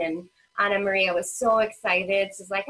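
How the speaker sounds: ordinary delivery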